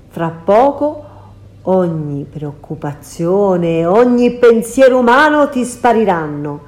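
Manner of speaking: loud, sneering witch voice; mocking shout. Circe jeering at Ulysses as she reveals the trap, loud. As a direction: jeer at the foolish guest. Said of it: "con la bocca storta gridare".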